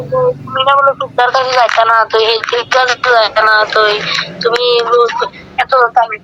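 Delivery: ordinary talk, nothing marked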